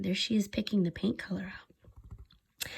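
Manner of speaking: hushed storytime aside; whispered aside. Real, not acted. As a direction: lean in and whisper. Reader whispers aside to child.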